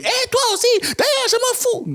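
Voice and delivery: mock of a wife snapping at husband; mock yelling wife. Shown as a demonstration. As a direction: play the rude wife who yells. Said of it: "Au lieu de te crier".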